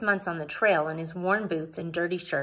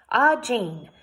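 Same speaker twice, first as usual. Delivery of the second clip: hearty announcement; 3 semitones higher, 8 dB louder than usual. A narrator's reading. Mister Swan finding Jane, announcing loudly. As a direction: hail her loudly and heartily. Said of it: "Mister Swan announced".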